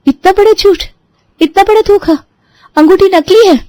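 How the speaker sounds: indignant high exclamation; outraged. Acted. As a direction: cry out at the betrayal. Bride discovers fake ring.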